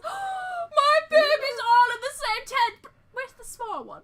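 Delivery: high, slow, wailing mock sob; hammed-up crying shopkeeper voice; a dramatic old dude, overjoyed; flamboyant old merchant. GM voices flamboyant NPC reacting.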